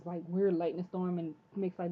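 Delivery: ordinary talk, nothing marked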